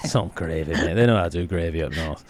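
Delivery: low, lightly put-on northern bloke; a hint of a gruff Yorkshire lad; Yorkshire accent. Teasing mate about northern gravy, mock northern voice.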